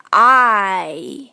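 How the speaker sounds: "I" opened wide and drawn back in; open-then-close I. Shown as a demonstration. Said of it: "张大再收回的感觉".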